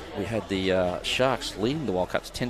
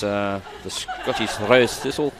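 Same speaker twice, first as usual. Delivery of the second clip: a deliberately bad, laughing Scottish accent; jokey mock Scottish accent from the commentator; slower than usual. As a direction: ham up a Scottish accent and crack up. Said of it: "That was a really bad attempt at a Scottish accent".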